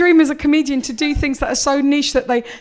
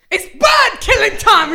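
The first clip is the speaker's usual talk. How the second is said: slow, higher shouted announcement; mock proclamation; 8 semitones higher, 4 dB louder, slower than usual. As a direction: boom it out like a battle cry. Absurd fake quote punchline.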